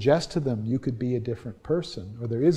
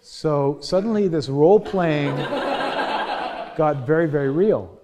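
real laugh through the words, louder; chuckling at how real it got; 4 semitones higher, 7 dB louder, slower than usual. Real, not acted. Lecturer tells his class of a role-play turning real, laughing. Laughs recalling alarming session.

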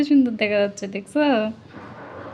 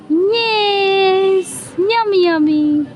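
delighted yum; 6 semitones higher, much slower than usual. Acted. Mother hams up tasting delight.

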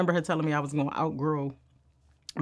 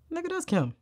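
high, pointed correction; emphatic correction; 13 semitones higher than usual. Real, not acted. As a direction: set them straight with a sharp high jab. Correcting people's view of Kim.

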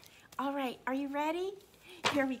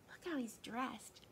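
ordinary delivery

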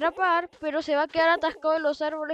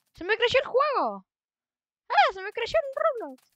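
amazed; 9 semitones higher, 5 dB louder than usual. Real, not acted. Amazed game grew.

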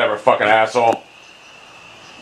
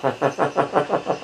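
stuttering laugh; 7 dB quieter than usual. Real, not acted. Gamer laughing at their own drives, staccato. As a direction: laugh in a run of short even pulses.

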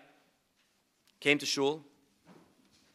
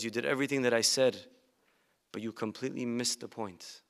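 softer, low disappointed mother; mother's sad rebuke; 4 semitones lower, 8 dB quieter than usual. Retold or imitated. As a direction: let her disappointment sink quietly.

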